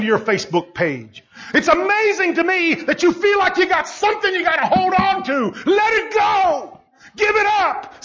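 high-pitched, driving sermon shout; fired-up preacher confronting the congregation; full-force preaching. Real, not acted. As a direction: preach hard, voice climbing high on the challenges.

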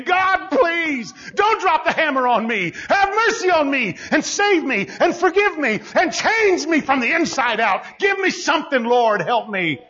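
high-pitched begging prayer; preacher acting a sinner's frantic plea; desperate pleading. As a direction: cry out to God for mercy in rapid appeals. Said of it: "you pleading with God".

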